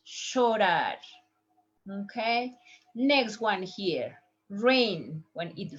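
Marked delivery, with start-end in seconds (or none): 0.1-1.0 llorar as "shorar"; sh pronunciation. Shown as a demonstration. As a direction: start llorar on a hushing sh. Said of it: "Listen the pronunciation".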